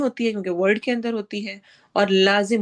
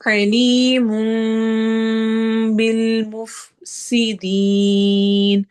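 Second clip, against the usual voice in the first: slow chanted tajweed with a long drawn ha; Quranic recitation; 4 dB louder, slower than usual. Shown as a demonstration. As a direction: recite it, stretching the vowel. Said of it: "میم میں بدل دیں گے".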